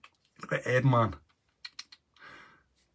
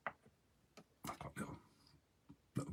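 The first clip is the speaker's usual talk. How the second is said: whisper; 21 dB quieter, slower than usual. Real, not acted. Emotional aside about egg cups.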